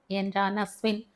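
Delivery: usual speaking voice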